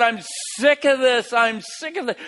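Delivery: frustrated yell. Acted. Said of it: "it's like he just yells out".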